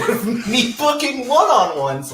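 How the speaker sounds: loud, rising laugh through the words; amused, laughing at yourself; laughing while talking. Real, not acted. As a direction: break up laughing mid-sentence. Laughs at own pestering of friends.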